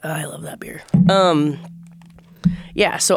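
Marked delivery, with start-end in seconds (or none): 0.0-0.8 hushed little whisper; whispered aside. Real, not acted. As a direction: say it under your breath. Aside about the beer, whispered.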